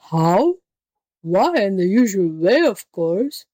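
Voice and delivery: incredulous, impatient beast voice; exasperated tiger. Acted. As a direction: answer as if it were utterly obvious. Impatient tiger answers foolish question.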